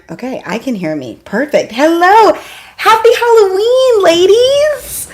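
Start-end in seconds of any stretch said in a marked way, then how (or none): 1.7-5.1 high and loud, cheerful hello; excited greeting. Real, not acted. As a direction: greet the viewers like a party host. Greeting viewers for Halloween.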